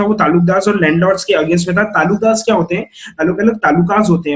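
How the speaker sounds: usual speaking voice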